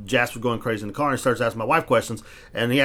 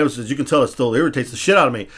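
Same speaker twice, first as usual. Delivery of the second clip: usual speaking voice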